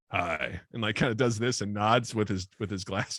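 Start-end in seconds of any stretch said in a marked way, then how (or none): 0.1-0.6 much lower, softer flat hi; creepy low greeting. Retold or imitated. Colin greeting narrator from the shadows, low and soft. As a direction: drop deep and quiet like a lurking creep. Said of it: "he just kinda goes".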